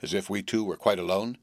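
ordinary delivery

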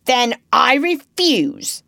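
loud, slow refusal; boy crying out his defiance, slow and loud; defiant cry. A narrator's reading. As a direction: hurl the refusal at the pirate. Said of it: "he cried".

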